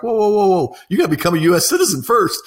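hammed-up stop-everything panic; alarmed whoa. Learning the student has a Fiji passport, mock alarm. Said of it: "It's like".

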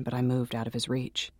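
ordinary delivery, nothing marked

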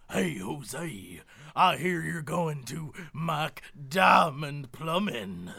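deep slow country plumber; playing Bubba for the bit. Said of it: "I'll be Bubba".

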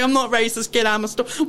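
ordinary talk, nothing marked

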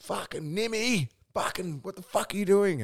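high-pitched mock yell; imitated yelling. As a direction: act out an exasperated mate yelling out. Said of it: "my mate was yelling out".

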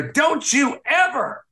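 high, drawn-out indignant cry; high-pitched mock outrage; retold outraged outburst. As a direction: imitate someone furious, stretching each word high. Retold outburst before a slap.

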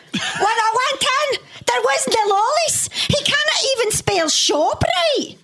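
put-on high child voice in Scottish accent; a kid's squeaky Scottish accent. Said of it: "your best slash worst Scottish accent".

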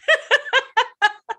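high giggle; a high, bright laugh. Real, not acted. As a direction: keep giggling at the joke. Laughing at her own joke.

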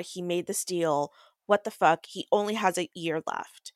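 normal talking voice

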